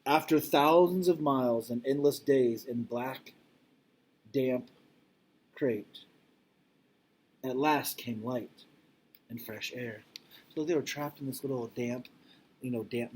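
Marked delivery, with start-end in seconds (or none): 0.1-10.0 slow, gentle read-aloud; storybook reading. Acted. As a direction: linger on each phrase for the children. Reads picture book to class.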